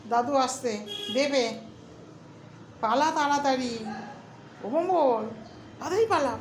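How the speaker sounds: ordinary delivery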